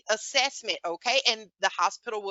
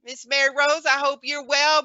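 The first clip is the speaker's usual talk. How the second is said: ordinary delivery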